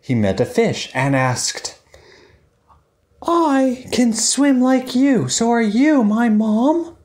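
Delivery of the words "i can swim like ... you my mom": high, small, hopeful duckling voice; a tiny duckling asking sweetly; little duckling voice. Acted. Story voice for little Dylan.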